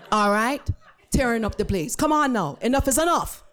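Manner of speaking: high, punchy Jamaican scolding; firm Jamaican-accented warning; thick Jamaican accent. Retold or imitated. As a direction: wrap up the telling-off in Jamaican. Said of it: "in a thick Jamaican accent".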